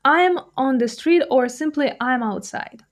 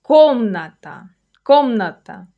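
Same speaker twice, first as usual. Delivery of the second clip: КОМ-ната, first syllable punched louder; stressed first syllable; 5 dB louder than usual. Shown as a demonstration. Teacher showing where the stress falls, first syllable stressed in комната. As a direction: hit the first syllable of комната hard, let the rest fall. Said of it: "Notice where the stress falls".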